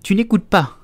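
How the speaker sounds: high, loud reprimand; stern mouse crying out; severe scolding. A narrator's reading. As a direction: snap at an inattentive listener. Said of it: "d'un ton sévère".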